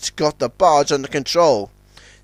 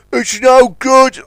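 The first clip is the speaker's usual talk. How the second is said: louder, slow, high; shouting; 9 semitones higher, 7 dB louder, slower than usual. A narrator's reading. Warrior shouting he can't hold the liner. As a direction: shout it over the wind. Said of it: "shouted Warrior".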